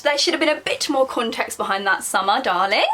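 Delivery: ordinary talk, nothing marked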